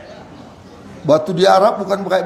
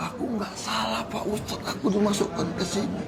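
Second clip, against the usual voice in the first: much softer, pleading like a weeping prisoner; tearful plea; 12 dB quieter than usual. Retold or imitated. Prisoner pleading softly to the ustadz, tearful. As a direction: beg quietly that you are innocent. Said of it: "Nangis dia sama saya".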